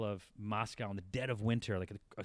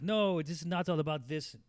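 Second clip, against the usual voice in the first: put-on a brief, half-hearted Russian accent, pitched higher; 6 semitones higher, 4 dB louder than usual. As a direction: start a mock Russian accent, then drop it. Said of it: "I won't try to do the accent".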